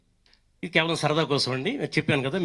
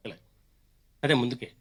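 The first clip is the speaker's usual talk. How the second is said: usual speaking voice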